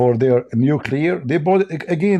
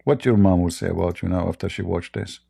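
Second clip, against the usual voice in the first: ordinary delivery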